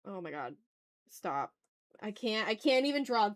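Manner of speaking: ordinary talk, nothing marked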